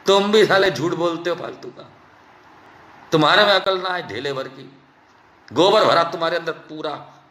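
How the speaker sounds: normal talking voice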